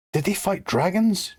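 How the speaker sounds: put-on high-pitched, excited child's question; eager shout. A narrator's reading. Chip interrupting to ask about dragons, an eager mock shout. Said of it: "Chip shouted".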